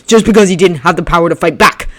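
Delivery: building tirade. Acted. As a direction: pile on the accusations.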